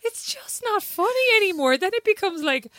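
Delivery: real laughter bending the voice high; helpless giggling. Real, not acted. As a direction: squeak it out while fighting giggles. Giggling protest after laughing fit.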